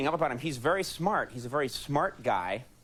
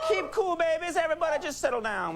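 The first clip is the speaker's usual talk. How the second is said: raised voice over the crowd; calling over applause. Real, not acted. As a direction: call out over the cheering. Host calming cheering crowd.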